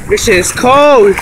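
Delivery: loud, slow, high complaint; freezing cold. Real, not acted. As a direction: drag it out, shivering in the cold. Standing in cold water.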